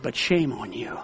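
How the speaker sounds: hushed, heavy rebuke; quiet scolding. Retold or imitated. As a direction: Joe quietly shames the church man. Joe scolds the church man.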